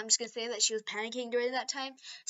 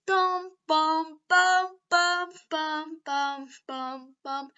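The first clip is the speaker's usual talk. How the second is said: beam sound effect; 5 semitones higher, 6 dB louder, much slower than usual. Acted.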